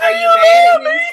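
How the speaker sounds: hammed-up wailing, high and loud; mock crying. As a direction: whine it like a fake sob. Mock-whining hurt partner skit.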